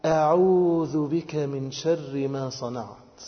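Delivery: slow, drawn-out recitation; solemn supplication. Shown as a demonstration. Worshipper seeking refuge in God, slow solemn recitation. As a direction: stretch the vowels with measured devotion.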